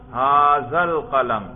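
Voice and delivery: drawn-out, projected example phrase; stretched classroom recitation; slow emphatic modelling. Shown as a demonstration. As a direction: pose it slowly for the pupil to translate. Teacher repeats phrase to be translated.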